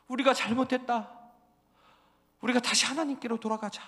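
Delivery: high, loud remorseful cry; the people admitting their sin; repentant people. Pretend. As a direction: wail a confession of wrongdoing.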